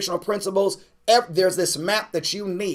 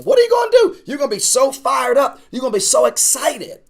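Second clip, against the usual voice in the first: much higher, louder preacher's shout; fired-up shout; 7 semitones higher, 5 dB louder than usual. Real, not acted. Preacher hyping up his class about seeing results, shouting. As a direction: lift the room with a thrilled shout.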